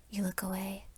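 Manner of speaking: usual speaking voice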